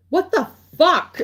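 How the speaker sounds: high, loud, slow exasperated what the fuck; drawn-out outraged cry; frustrated yell. Retold or imitated. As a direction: shout it at the sky in disbelief. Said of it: "I'd be like".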